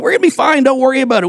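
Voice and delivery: high-pitched brush-off; acted breezy dismissal. Ship's crew reassuring the centurion, breezily dismissive.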